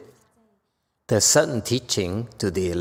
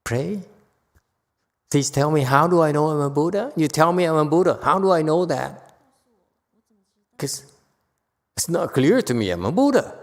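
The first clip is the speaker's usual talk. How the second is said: mock pleading; 5 semitones higher than usual. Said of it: "Punya Yasha is being very smart".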